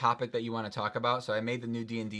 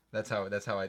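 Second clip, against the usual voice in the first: normal talking voice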